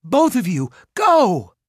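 high, loud urgent order; frantic high command; shouting. A narrator's reading. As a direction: yell at them to get out, rising on the last word. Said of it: "Mallory shouted".

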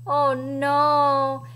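ordinary delivery, nothing marked

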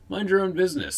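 high, loud snap; snapping. A narrator's reading. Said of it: "snapped Gleaner".

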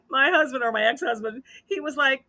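ordinary delivery

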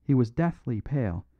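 ordinary delivery, nothing marked

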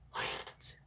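voiceless whisper. A narrator's reading. Father naming each diner's origin to his child, whispering. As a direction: drop to a hushed whisper.

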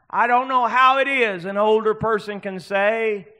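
impassioned pulpit delivery; full-force preaching. Real, not acted. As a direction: project it high and strong to the congregation.

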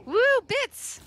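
high loud woo; whooping at a viewer's donation; excited whoop. Real, not acted. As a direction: cheer like a game show fan. Cheering for donated bits.

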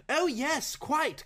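high, breathless eager yes; eager gasp. Acted. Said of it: "gasped Mister Saltena".

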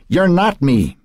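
flaring. A narrator's reading. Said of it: "Matt flared".

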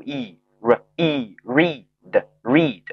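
slow R plus long E into "read"; long E blending. Shown as a demonstration. Teacher showing the exception word read, slow long-E blending. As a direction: draw out the ee in read. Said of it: "它这里就读E".

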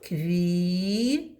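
usual speaking voice

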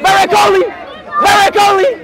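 high, loud calling out; shouting out. Real, not acted. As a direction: yell the name across. Calling out to cricketer.

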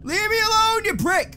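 high frantic yell at the monster; real fear, a shrill cry to be let go; panicked shout. Real, not acted. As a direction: scream him off in panic. Being grabbed by monster.

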